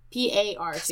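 ordinary talk, nothing marked

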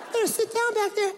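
very high-pitched driver's order; uncaring bus driver. Retold or imitated. Bus driver ordering a kid to sit, high put-on voice. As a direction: call it to the back, high and strained. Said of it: "the bus driver doesn't give a shit".

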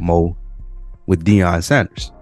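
ordinary talk

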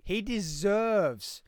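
slow, drawn-out very English delivery. Real, not acted. Arguing for a fighter, slow and very English. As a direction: stretch it out in very English tones. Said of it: "Very English".

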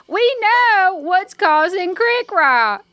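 high, slow proclamation. Acted. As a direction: announce it slowly to those upstairs. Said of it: "Let me raise my voice".

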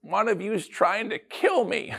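mock-solemn aunt at the holiday meal; dramatic Aunt Irma. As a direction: play the aunt springing news on the table. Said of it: "Aunt Irma stands up and says".

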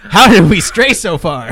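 loud, high, through laughter; real laughter bursting into the words; laughing. Real, not acted. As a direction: cry it out while cracking up. Cracking up at the digression.

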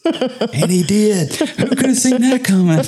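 high-pitched, laughing delivery; helpless giggly squeal through the words. Real, not acted. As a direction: crack up while delivering the punchline. Laughing at own joke prediction.

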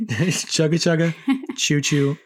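playful chugga chugga choo choo; chugging toy train voice. Pretend. Joking train sound effect.

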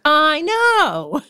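put-on a kid feeling finally understood; mock kid agreement. Host imitating a teen who feels heard, high and emphatic. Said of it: "they can be like".

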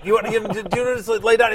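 mock concerned opponent. Retelling a film duel, concerned opponent.

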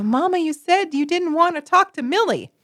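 put-on high, indignant child blurting it out; kid blurting out. Upset child calling out mother's lie, high and blurted. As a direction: let it slip out like an upset kid. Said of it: "you might even blurt out".

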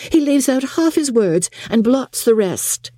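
high, exasperated lady; Miss Bingley, exclaiming. A narrator's reading. Sister complaining of her brother's careless writing, exclaiming.

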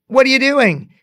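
high, loud alarmed question; shocked disbelief, loud and high. Retold or imitated. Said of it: "and I'm like".

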